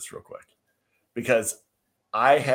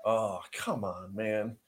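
softer, slow groan of protest; weary older fans; 7 dB quieter, slower than usual. Retold or imitated. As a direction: imitate fed-up spectators, slow and quiet. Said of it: "all these old people are just like".

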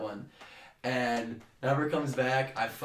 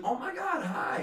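mock excited greeting; 12 semitones higher than usual. Girl greeting him excitedly.

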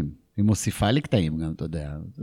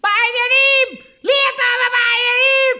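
furious wife shriek; 9 dB louder than usual. Retold or imitated. Wife explodes at husband's greeting. (אישה צורחת בזעם בקול גבוה מאוד)

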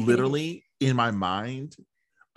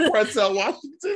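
high squealing laugh through Pretzel Washington; 13 semitones higher, 7 dB louder than usual. Real, not acted. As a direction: laugh out the joke name. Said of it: "joke mixing names".

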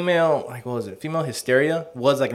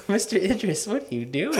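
flustered woman voice; 6 semitones higher, 4 dB quieter than usual. Acted.

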